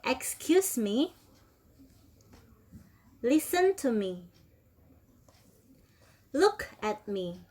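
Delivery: slow, clear drill phrases for students to repeat; teacher model reading. Shown as a demonstration. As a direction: model each phrase slowly for the class to copy. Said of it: "Please everybody repeat after me".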